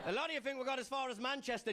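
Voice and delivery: ordinary talk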